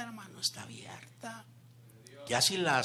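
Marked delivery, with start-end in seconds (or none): 0.0-1.5 soft hushed whisper; whispering to the congregation that the door is open. Real, not acted. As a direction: drop to a whisper to share the good news.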